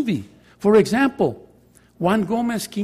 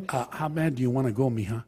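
put-on softer, lower, coaxing the crying girl; gentle question; 6 semitones lower, 7 dB quieter than usual. Counselor softly coaxing a tearful student, gentle. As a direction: ask it gently, like a caring counselor.

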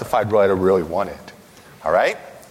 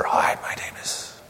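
breathless whispered hello; whispering; 6 dB quieter than usual. Pretend. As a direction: whisper it like a shy superhero. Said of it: "They all speak with a whisper".

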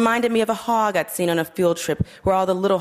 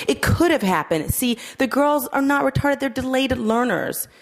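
higher, sugary teacher tone; syrupy instructional; 9 semitones higher than usual. A narrator's reading. Troop leader explaining her girls, syrupy and instructive. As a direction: talk down sweetly like a kindergarten teacher. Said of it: "in a syrupy instructional voice".

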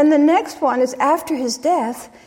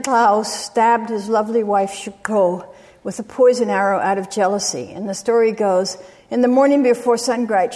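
ordinary delivery

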